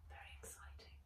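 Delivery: unvoiced whispered aside. Real, not acted. Reader whispering a teaser to listeners. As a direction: breathe it as a secret. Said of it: "whispered aside".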